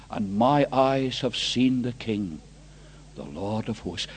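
low, hushed confession with long pauses; grave scripture recitation. Acted. Preacher reciting Isaiah's confession of unclean lips, low and grave. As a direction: drop down low and read it solemnly.

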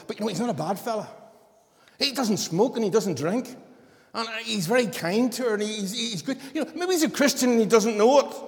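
high, loud, rushed voice of a fretting mother; mother talking the pastor round, high and pressing; pleading worried mother. Retold or imitated. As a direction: plead a boyfriend's case like an anxious mum. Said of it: "And she come back and says".

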